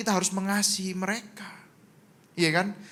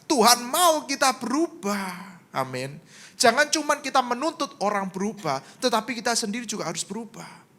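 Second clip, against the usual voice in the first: usual speaking voice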